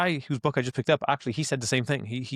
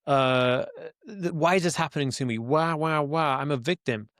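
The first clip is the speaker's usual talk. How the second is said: whiny victim; 3 semitones higher, slower than usual. Retold or imitated. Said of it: "I could have gone like".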